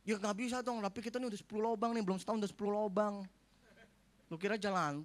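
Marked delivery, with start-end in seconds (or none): none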